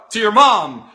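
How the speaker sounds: ordinary talk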